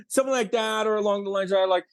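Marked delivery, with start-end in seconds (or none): none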